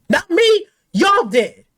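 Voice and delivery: ordinary talk, nothing marked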